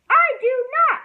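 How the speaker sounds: put-on high, loud child's shout. A narrator's reading. Ramona shouting at her sister, high and loud. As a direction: yell it back hard. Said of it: "shouted Ramona".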